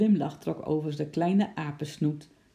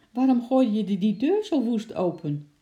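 higher, louder scolding in pain; annoyed scolding; 6 semitones higher, 6 dB louder than usual. Acted. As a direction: scold him for the flung door. Father hit by the door.

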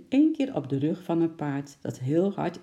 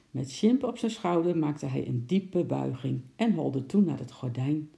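ordinary delivery

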